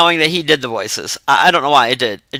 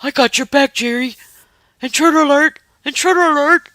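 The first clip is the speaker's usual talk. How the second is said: high, eager guard-dog voice, pitched way up; goofy loyal dog voice; 8 semitones higher than usual. Retold or imitated. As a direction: play the dog sounding the alarm for Jerry. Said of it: "the dog would".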